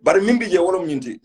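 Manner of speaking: ordinary delivery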